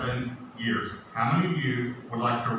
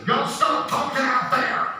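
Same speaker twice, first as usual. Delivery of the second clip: high, loud, drawn-out yell; imitated mom's sudden holler; mother yelling at kids; 13 semitones higher, 5 dB louder than usual. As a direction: holler it across to the kids outside. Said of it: "then she did this".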